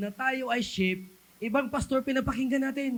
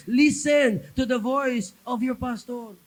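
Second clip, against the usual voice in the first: loud exhortation; 3 semitones higher, 6 dB louder, slower than usual. Real, not acted. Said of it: "repeated exhortation".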